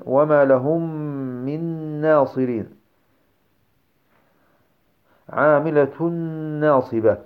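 "من ناصرين" with a long held nasal N; merge into noon. Shown as a demonstration. Teacher giving examples of the noon, held with ghunnah. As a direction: recite "عاملة ناصبة" as "amilatunnn-nasiba". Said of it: "النون".

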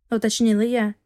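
usual speaking voice

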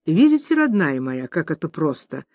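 plain reading voice

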